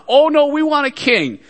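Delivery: put-on a touch of childish whining; whiny people. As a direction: pout it like a stubborn kid. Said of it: "They whined".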